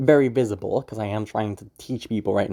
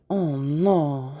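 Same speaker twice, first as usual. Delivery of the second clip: OH NO drawn out, barely reacting; understated reaction; 3 semitones higher than usual. Shown as a demonstration. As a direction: stretch "Oh no" in the feminine voice without punching it. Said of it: "not that big of a reaction".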